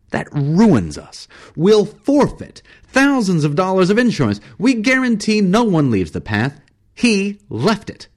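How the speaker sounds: high-pitched, loud outrage; irate guide ranting at a blunder; furious rant. Acted. Travis rages about the broken rules.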